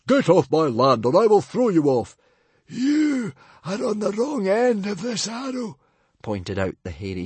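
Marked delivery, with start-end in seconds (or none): 0.1-2.2 loud, higher Viking chief bellow; a chief defending his island, loud and furious; threatening chief. A narrator's reading. Stoic threatens the librarian. 2.7-5.8 high librarian voice, coolly pointed; a high, smug archer making his point. A narrator's reading. Librarian answers Stoic.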